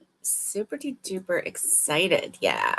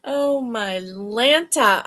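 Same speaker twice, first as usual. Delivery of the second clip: slow, stretched exclamation; slow drawn out; 3 dB louder, slower than usual. Real, not acted. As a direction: draw out each word.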